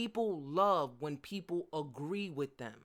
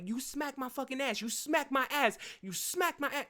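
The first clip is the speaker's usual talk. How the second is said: indignant yelling; 8 semitones higher than usual. Pretend. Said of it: "I would be like".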